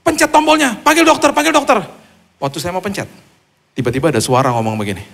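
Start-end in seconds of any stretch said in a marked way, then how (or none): 0.1-1.8 high, loud panicked yell; wife's panicked scream. Retold or imitated. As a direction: scream for the nurse button in panic. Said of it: "Istri saya teriak". (istri berteriak panik, nada tinggi dan keras)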